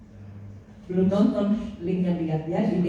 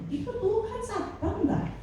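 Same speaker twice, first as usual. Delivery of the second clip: mocking question; 5 dB quieter than usual. Real, not acted. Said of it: "mocking rhetorical question".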